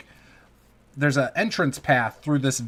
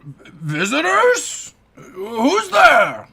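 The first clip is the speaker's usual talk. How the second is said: put-on high, slow, loud gruff old voice; gruff old orc; 9 semitones higher, 8 dB louder, much slower than usual. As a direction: call out like a suspicious old orc. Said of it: "you hear a gruff voice inside ... sounds like that of an old, older person".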